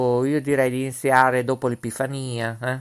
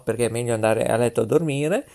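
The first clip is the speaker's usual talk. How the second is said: ordinary talk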